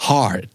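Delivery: HARD with the middle R sounded; American-style HARD, clear r-sound. Shown as a demonstration. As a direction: curl the R before the D in HARD. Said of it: "มันจะมี R ที่อยู่กลางคำด้วย".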